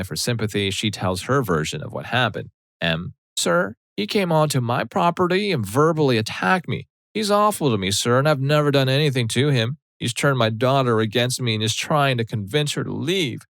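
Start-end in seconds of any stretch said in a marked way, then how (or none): 3.4-13.4 put-on high, whiny frail old woman voice; fragile old lady act. Mom pleading with police officer in a high frail old lady voice. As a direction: play the helpless victim to the cop. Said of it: "fragile, brittle old lady act".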